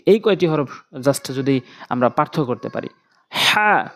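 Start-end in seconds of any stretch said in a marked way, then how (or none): 3.3-3.9 হা squeezed from mid-throat, pitched high; pressed throat H. Shown as a demonstration. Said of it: "এটা চাপ দিয়ে".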